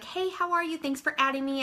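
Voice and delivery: cheerful hello to a new friend; friendly demo message. Shown as a demonstration. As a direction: sound delighted to connect. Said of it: "Just a couple of sentences like".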